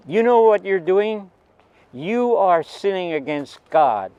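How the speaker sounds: a religious aunt yelling a rebuke; retold aunt's scream. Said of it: "the aunt came screaming out".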